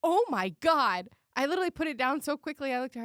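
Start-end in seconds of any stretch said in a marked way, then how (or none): 0.0-1.1 slow shocked. Retold or imitated. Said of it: "I was like".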